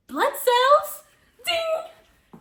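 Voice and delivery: high, loud triumphant quiz answer; excited game-show ding. Retold or imitated. Girl retelling her test win, with a bright ding. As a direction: ring out the answer like a buzzer.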